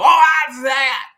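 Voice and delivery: mock dismissive shout. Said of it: "shut down and go".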